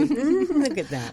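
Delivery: slow laughing tease. Real, not acted. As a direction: laugh while pointing it out. Teasing after throat clear.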